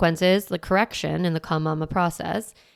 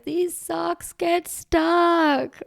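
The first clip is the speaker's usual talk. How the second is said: put-on much higher, very slow small child whine; little kid voice; 11 semitones higher, much slower than usual. Mom mimicking her child complaining about socks, in a high kid voice. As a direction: squeak it out like a stuck little kid.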